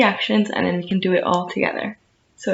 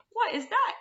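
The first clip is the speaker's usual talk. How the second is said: high, startled little ant; a tiny ant jolted by a splash; startled cry; 11 semitones higher, 6 dB quieter, faster than usual. A narrator's reading. Ant startled by a big wave, high surprised cry. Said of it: "cried the ant".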